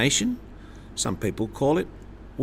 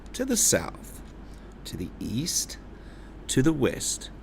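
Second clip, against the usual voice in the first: pitch follows direction; 3 semitones higher, 7 dB quieter than usual. Shown as a demonstration. Teacher leading the class in compass points. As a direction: paint each direction with the voice. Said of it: "Can you please say that now".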